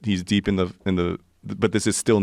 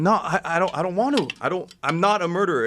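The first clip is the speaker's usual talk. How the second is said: high, fast, panicked protest; frantic denial; 8 semitones higher than usual. Retold or imitated. Retelling a jungle night, insisting he's no murderer, high and fast. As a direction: plead your innocence in a rush, pitched up. Pleading he won't kill.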